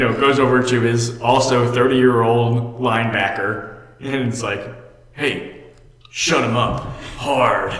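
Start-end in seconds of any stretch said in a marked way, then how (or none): 5.1-7.7 lightly hammed-up villain coach, drawn out. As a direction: imitate a cartoon-villain coach giving a slow order. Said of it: "nobody talks like this".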